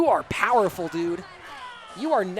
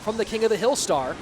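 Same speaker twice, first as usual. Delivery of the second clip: energetic esports shoutcast; live play-by-play; 2 semitones higher than usual. Real, not acted. Esports caster calling the end of a game, play-by-play. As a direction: call the match live with punchy emphasis.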